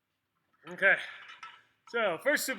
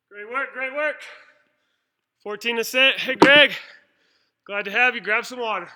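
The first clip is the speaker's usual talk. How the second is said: calling out; 3 semitones higher, 14 dB louder than usual. Real, not acted. Calling out to arriving gym member.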